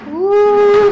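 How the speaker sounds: high, loud cheer; excited whoop. Real, not acted. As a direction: whoop to fire up the class. Instructor cheering on class.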